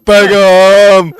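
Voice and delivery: loud amused name call; giggling exclaim; laughing at the name. Real, not acted. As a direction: blurt the name through laughter.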